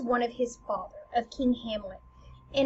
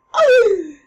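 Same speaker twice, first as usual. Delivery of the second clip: loud theatrical gasp; big high gasp, hammed up; shocked gasp; 9 semitones higher, 16 dB louder than usual. Queen hearing the accusation, loud shocked gasp.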